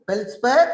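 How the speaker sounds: feldspar, quartz, mica called out high and loud, one by one; drill words for class. Shown as a demonstration. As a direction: model each mineral name for the students to repeat. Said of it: "Once again". (తరగతికి ఒక్కొక్క పదాన్ని పెద్దగా పలికి చూపించే ఉపాధ్యాయుడు)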